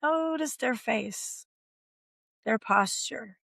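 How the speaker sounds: calm, slow visualization voice. Acted. Guided visualization.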